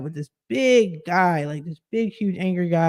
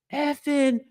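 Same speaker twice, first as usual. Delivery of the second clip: mock cursing; 8 semitones higher, 4 dB quieter than usual. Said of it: "start instantly cursing".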